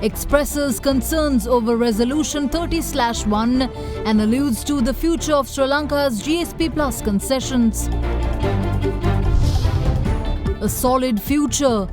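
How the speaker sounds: punchy headline read over music; news headlines. Acted.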